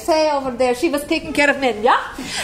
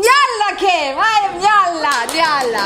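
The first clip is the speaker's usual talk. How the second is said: clown character intro; 2 semitones higher than usual. Retold or imitated. To Menya, Randy arriving as the clown, announcing herself grandly. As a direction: present yourself grandly as the clown.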